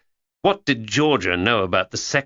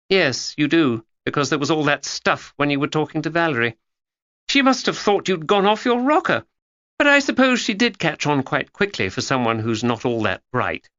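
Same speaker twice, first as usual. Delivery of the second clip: higher, brisk schoolgirl voice; a know-it-all girl confiding; young Georgia; 7 semitones higher than usual. A narrator's reading. Georgia tells Hugo her theory, higher girlish voice.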